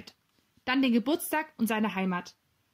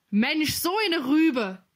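high, drawn-out exclamation, louder; calling out; 6 semitones higher, 5 dB louder, much slower than usual. A narrator's reading. Gustav to the others about the thief, calling out. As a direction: exclaim it slowly in a high, raised voice. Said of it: "rief Gustav".

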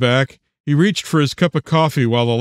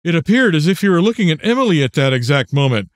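ordinary delivery

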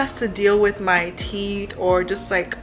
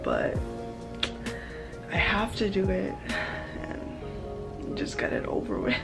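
quiet and anxious; 9 dB quieter than usual. Real, not acted. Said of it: "But I'm so anxious".

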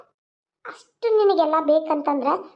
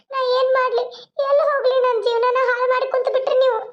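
distressed complaint; 6 semitones higher than usual. Acted. Deserted wife's distressed outburst.

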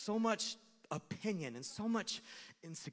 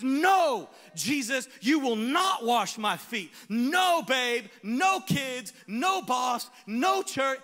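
shouted refusal, pitch high and loud; defiant shouting no; 7 semitones higher, 12 dB louder, much slower than usual. Retold or imitated. The hurt heart yelling no at Jesus. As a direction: bellow no at Jesus and everyone.

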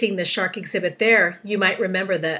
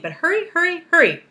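high, repeated, mounting urgency; urging; 9 semitones higher, 8 dB louder than usual. A narrator's reading. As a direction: hurry them along frantically. Centipede urging James to hurry.

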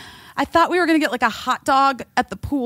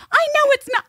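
high pleading self-quote; much faster than usual. Retold or imitated. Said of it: "I was like".

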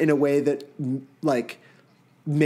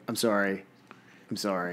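soft, low, deadpan "I'm sorry"; mock monotonous reading; monotone apology; 5 semitones lower, 7 dB quieter than usual. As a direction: mumble "I'm sorry" quietly, without any lift. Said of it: "two hours of you going".

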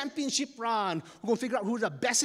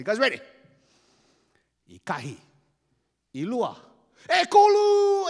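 referee countdown building to shout; 5 semitones higher, 9 dB louder than usual. Acted.

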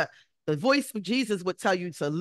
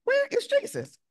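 alarmed searching. Acted. Disciples searching for missing Jesus.

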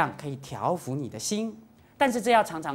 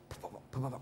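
soft, low ball thuds; quick muffled bounce noises; bouncing ball imitation; 6 semitones lower, 17 dB quieter, faster than usual. Shown as a demonstration. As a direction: imitate a basketball dribbling.

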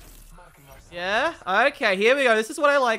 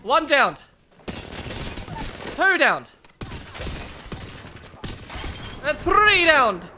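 triumphant count; 4 semitones higher, slower than usual. Real, not acted.

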